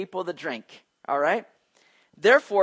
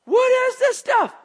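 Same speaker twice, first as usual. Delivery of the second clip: much higher, louder, slow puzzled cry; hammed-up bewilderment; 8 dB louder, slower than usual. Preacher acting out Israelites gaping at manna, hammed-up amazement. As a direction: squeal it like amazed people seeing strange food. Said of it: "all looking out there going".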